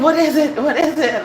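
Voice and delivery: high, loud repeated question; insistent curiosity. Retold or imitated. Runner pressing the dog owner, mock urgent curiosity. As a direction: imitate someone desperate to find out. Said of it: "he really wanted to know".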